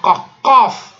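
qaf, qaf hit hard and loud from the throat; mock the beginner who forces the qaf; exaggerated throaty qaf; overdone qaf. Shown as a demonstration. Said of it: "il va faire qaf".

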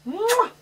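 high-pitched "mwah" kiss; kiss sound. Real, not acted. Kissing noise at the snake.

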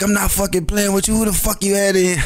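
demanding, pushing her hard; angry boyfriend. Retold or imitated. Boyfriend grilling her about a visitor, mock angry. Angry man confronting caught girlfriend.

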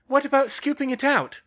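high, loud suggestion; high female character voice calling across; Hermione's voice. A narrator's reading. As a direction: pitch it well up, projecting. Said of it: "Hermione suggested".

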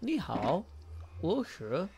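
halting beginner's Mandarin with exaggerated tones; slow, careful, badly pronounced Chinese; terrible Chinese. Shown as a demonstration. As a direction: attempt Mandarin tones slowly and clumsily. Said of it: "That's a terrible, terrible Chinese".

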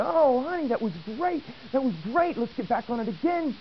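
high, bright-pitched cheering up; pep her up after the fall; upbeat encouragement. Retold or imitated.

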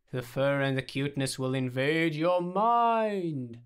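drawn-out ominous build; slow, theatrical sci-fi menace, rising at the end; War of the Worlds narrator. A narrator's reading. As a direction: swell into a melodramatic doom narration. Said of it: "as if he was narrating War of the Worlds".

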